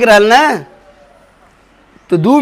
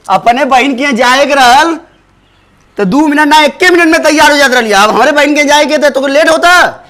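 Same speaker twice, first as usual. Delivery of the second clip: higher-pitched irritated complaint; annoyed scolding; 5 semitones higher, 4 dB louder than usual. Acted. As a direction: scold the wife for dawdling. Husband scolds wife over delay. (खिसिया के ऊँच सुर में ताना)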